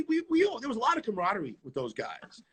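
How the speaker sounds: usual speaking voice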